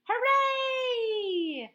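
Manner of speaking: high, loud drawn-out cheer; joyful cheer. Acted. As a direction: cheer with all your heart. Child cheers when allowed.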